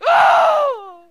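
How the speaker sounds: put-on a high, loud child's yelp; yelp of pain. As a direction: cry out as the kid hit on the knuckles.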